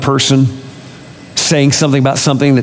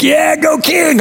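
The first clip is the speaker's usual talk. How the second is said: high-pitched mock cheer; root for the hero, voice leaping up; much slower than usual. Movie watcher rooting for the king, cheering.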